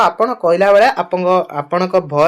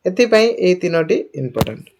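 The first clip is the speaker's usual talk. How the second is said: ordinary talk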